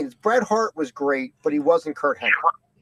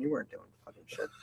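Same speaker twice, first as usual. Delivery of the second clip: whispered jab; 16 dB quieter, faster than usual. Real, not acted. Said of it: "teasing aside to co-host".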